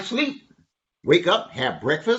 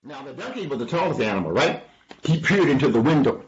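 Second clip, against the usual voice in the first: plain reading voice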